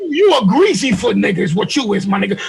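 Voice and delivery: high-pitched, hammed-up angry rant; mock insult tirade. Acts out a hypothetical insulting tirade.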